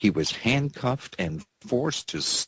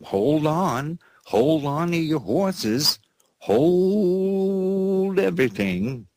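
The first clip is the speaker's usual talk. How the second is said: dramatic halt; 8 semitones higher, 3 dB louder, slower than usual. Acted. Dramatic halt before next story.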